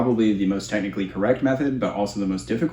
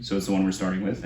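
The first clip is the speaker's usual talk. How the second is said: normal talking voice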